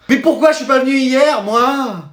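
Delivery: high, drawn-out lament in a regretful whine; regretful wail. Acted. Pupil learns he missed a great day, wailing regret. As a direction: wail in mock despair at missing out.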